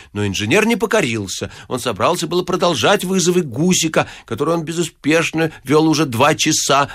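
ordinary delivery

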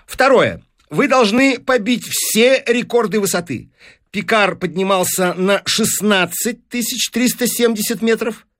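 high-pitched, emphatic deal-maker; demanding newspaper businessman. Acted. Businessman setting the professor a record to beat, insistent. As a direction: lay down terms with brisk authority. Voices Fines pressing demands.

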